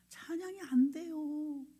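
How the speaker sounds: high, drawn-out mock whine; whining. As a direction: imitate someone whining tearfully, stretching the words. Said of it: "막 징징거리고 울어요". (징징거리는 흉내)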